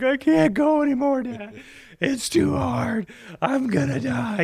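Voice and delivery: high, wailing mock cry of an exhausted kid; imitating son sobbing. Dad retelling his son crying on the steep pack-out, in a high mock wail. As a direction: play the worn-out boy breaking down in tears, pitched well up. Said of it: "like, sobbing".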